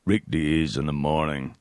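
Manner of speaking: low, slow announcer tag; radio jingle. Retold or imitated. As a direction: drop deep and stretch the station ID. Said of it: "Then he'd play the jingle".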